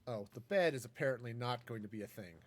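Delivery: ordinary talk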